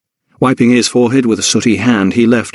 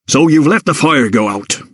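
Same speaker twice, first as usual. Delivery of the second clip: stern valet; 5 semitones higher than usual. A narrator's reading. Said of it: "The voice of Rafferty".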